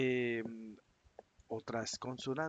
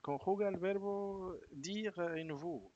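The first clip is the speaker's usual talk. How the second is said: mock examiner instruction, higher and slower; imitating an exam prompt; 6 semitones higher, slower than usual. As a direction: read it like a test question, slowly. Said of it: "te dirán". (imitando la consigna del examen, lenta)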